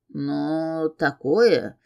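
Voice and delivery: low, distrustful and hesitant; doubtful. A narrator's reading. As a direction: say it with a frown of doubt. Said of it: "недоверчиво нахмурился Шайтар".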